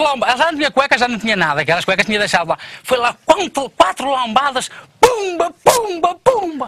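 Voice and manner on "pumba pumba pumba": higher, louder sound words; hammed slap noises. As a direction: punch out each slap.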